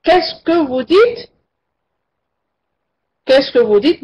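rising, puzzled question as if he misheard; teacher acting a man who did not catch the words; asking to repeat. Shown as a demonstration. Teacher shows a man who misheard, puzzled rising question. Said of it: "elle va dire".

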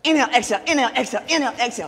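high, loud chanted inhale exhale; loud rhythmic breathing cues. Shown as a demonstration. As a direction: call out the breath rhythm energetically. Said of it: "Dog breath is a powerful pranayama".

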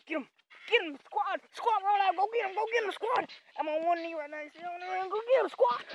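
high, loud mock battle cry; frantic play-acted rallying shout; urging on. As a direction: holler the troops into a pretend attack. Urging the squad to attack.

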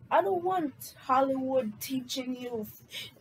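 ordinary talk

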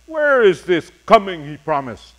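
usual speaking voice

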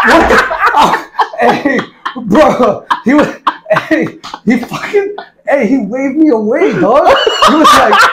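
cracking up mid-story, pitch riding high; real laughing. Real, not acted. Telling how a recruit waved him away, cracking up. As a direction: struggle to get the words out through laughter.

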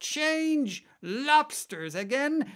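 very high, stretched-out holler; top-of-the-voice yell. A narrator's reading. Gryphon calling the next dance step, high drawn-out yell. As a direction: yell it with mounting excitement. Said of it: "yelled the Gryphon at the top".

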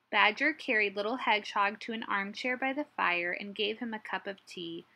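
usual speaking voice

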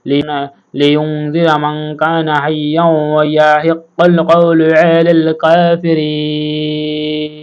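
slow, drawn-out chanted recitation; Quran recitation. Acted. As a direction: stretch each vowel like tilawat. Recites the full verse.

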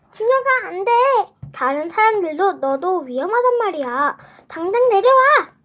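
loud, high warning shout; mom shouting. Acted. Mom yelling at 준혁 on the cart, alarmed. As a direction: stop him before he crashes.

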